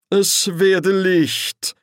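very slow, high, intoned proclamation; solemn command. A narrator's reading. Angel commands light to appear, solemnly intoned. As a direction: draw out each word like a divine decree.

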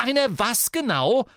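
high, loud challenge; angry shout. A narrator's reading. Sister challenges brother to finish his insult, shouting. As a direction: demand it at a shout. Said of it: "rief Ginny und zog ihren Zauberstab".

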